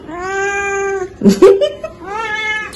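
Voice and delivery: high-pitched giggle; high laugh. Real, not acted. Laughing at the cat.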